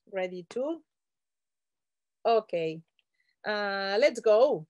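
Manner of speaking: usual speaking voice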